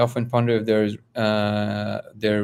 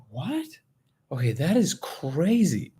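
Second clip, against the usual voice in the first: high rising what, then slower amazement; hushed high disbelief, then stretch it out; retold astonishment; 5 semitones higher, slower than usual. Speaker hears the matching detail, retold astonishment. Retelling own stunned reaction.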